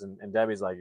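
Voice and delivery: ordinary delivery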